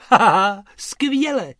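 loud laugh into high praise. Real, not acted. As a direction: laugh with delight. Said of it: "Dobře".

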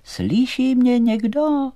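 high, loud, drawn-out call; little doll calling out. A narrator's reading. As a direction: call slowly into the distance on a high sustained note.

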